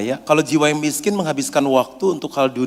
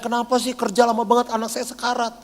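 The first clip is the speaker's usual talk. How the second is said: mock angry father; 7 semitones higher than usual. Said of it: "rumah sakit dimarahin".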